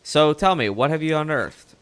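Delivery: put-on old-timey Mid-Atlantic reporter voice; Mid-Atlantic accent. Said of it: "my, uh, Mid-Atlantic act-, accent".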